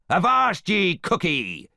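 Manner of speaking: loud, high pirate cry; theatrical buccaneer threat; Captain Hook. A narrator's reading. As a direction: bellow like a menacing pirate. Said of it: "cried Captain Hook".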